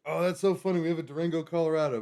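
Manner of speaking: light up at the funny match; amused surprise. Real, not acted. Amused by shared place name.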